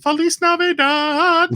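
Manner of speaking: high, slow sing-song yell; imitated yelling. Host imitating the band swapping in Feliz Navidad, high yelled wail. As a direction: yell it like a yelped chorus, stretched out high. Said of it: "they start yelling".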